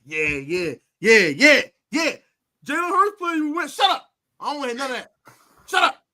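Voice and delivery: high, gleeful taunting; gloating taunt. Retold or imitated. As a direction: rub it in. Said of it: "I'm like".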